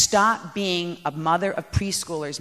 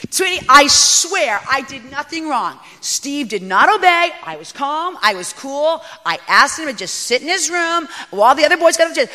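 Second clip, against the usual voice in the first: self-justifying plea; 7 semitones higher, 8 dB louder than usual. Retold or imitated. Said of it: "telling Dad our side of the story".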